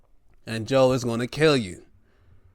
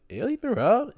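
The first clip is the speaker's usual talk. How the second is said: disgusted surprise; 9 semitones higher than usual. Retold or imitated.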